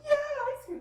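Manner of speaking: loud, very high cheer; matched excitement. Acted. Mom matching her kids' ice cream joy, excited. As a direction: cheer along like an overjoyed kid. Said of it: "we match that excitement".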